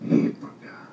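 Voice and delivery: hushed, voiceless whisper imitating her answer; retold whisper. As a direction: whisper her reply as a quiet revelation. Retells her hushed, momentous answer.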